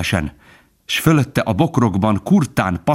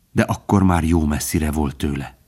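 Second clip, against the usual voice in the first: ordinary delivery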